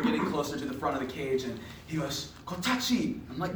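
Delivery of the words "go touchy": imitated tour guide in broken English, higher pitched; non-English-speaking tour guide. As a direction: lightly mimic a foreign guide's halting English. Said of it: "he didn't speak any English".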